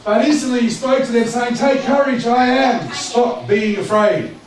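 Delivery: loud, high proclaimed Bible verse; scripture reading. Acted. Preacher reads Jesus calming the disciples, loud commanding proclamation. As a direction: ring out the command, slowing hard at the end.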